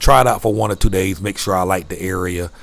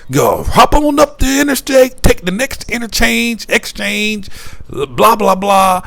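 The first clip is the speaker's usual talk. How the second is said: high, louder mock Canadian directions; Canadian accent; 13 semitones higher, 5 dB louder, slower than usual. Clerk giving directions, high Canadian accent. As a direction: rattle off the route in the clerk's Canadian voice. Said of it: "I can't make the accent".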